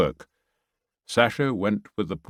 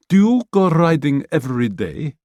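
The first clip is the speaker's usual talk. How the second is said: higher, louder woman's voice; eager question to Pamela; curious Galina; 6 semitones higher, 6 dB louder than usual. Acted.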